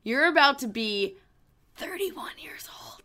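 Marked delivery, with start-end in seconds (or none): 1.8-3.0 teasing stage whisper; hushed mock-ominous whisper. Teasing friend about his age.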